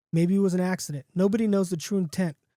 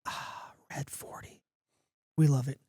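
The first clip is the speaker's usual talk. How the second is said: savouring whisper; 4 semitones lower, 5 dB quieter than usual. Acted. Drinker after a refreshing sip, whispered satisfaction. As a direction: sigh the brand out in a soft whisper.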